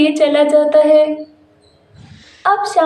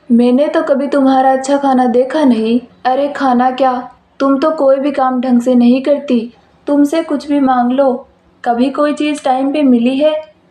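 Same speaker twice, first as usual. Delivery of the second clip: lowered male voice, mildly angry; scolding husband; 3 semitones lower than usual. Acted. Husband scolds wife over burnt dinner, lowered male voice. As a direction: grumble about the burnt food, voiced low.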